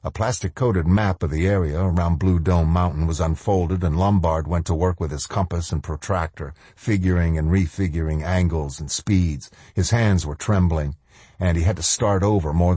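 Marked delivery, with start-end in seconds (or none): none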